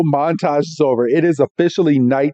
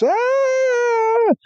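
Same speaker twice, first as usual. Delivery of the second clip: high mock scream; sustained high-pitched "ah" cry; imitated scream. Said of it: "you hear a crash".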